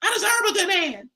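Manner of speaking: high-pitched mimicry of complaining women; mock whiny women. Mimicking women who want a good man, high mock plea. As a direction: wail it high, imitating women. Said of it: "then they be like".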